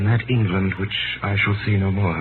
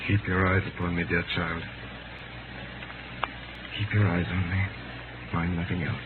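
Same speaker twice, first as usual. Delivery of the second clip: softer, gentle comforting voice; soft reassurance; 3 semitones lower, 9 dB quieter than usual. Acted. Condemned man calming a frightened girl, soft and tender. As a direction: hush her fears, close and quiet.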